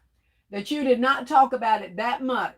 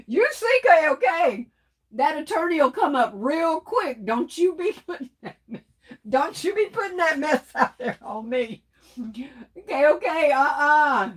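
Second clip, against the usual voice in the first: higher-pitched playful scolding with laughs; teasing sass; 5 semitones higher than usual. Real, not acted. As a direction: wag a finger at her, laughing. Teasing KOK about her attorney side.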